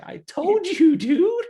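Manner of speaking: high, loud retold told-you-so; told-you-so shout. Manager to the robber, high loud told-you-so shout.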